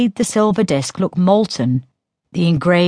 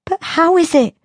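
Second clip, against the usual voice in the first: put-on higher, light teasing girl's voice; girl Synth voice; 13 semitones higher than usual. A narrator's reading. Sister taunting Diello about his tale, in a higher teasing voice. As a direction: taunt him as the younger sister.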